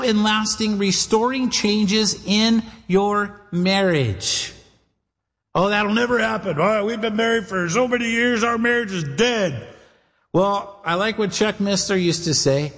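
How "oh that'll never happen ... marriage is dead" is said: whiny put-on voice of a discouraged spouse; a hint of a defeated husband or wife; mock despairing spouse.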